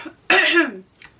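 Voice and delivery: sharp loud throat clearing; throat clearing cough. Real, not acted. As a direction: clear the throat hard mid-sentence. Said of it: "sorry if I'm coughing a lot".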